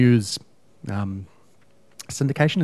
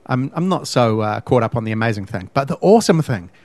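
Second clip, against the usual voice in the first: usual speaking voice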